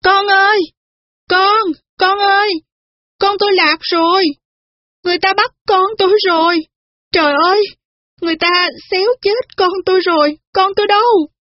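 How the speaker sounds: put-on a panicked mother screaming through the street for her baby; high-pitched wailing call, near breaking; frantic calling out. A narrator's reading. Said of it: "miệng kêu lên".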